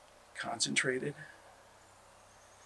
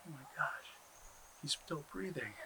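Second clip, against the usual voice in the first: hushed relief; 14 dB quieter than usual. Acted. Relief at Turtle breathing.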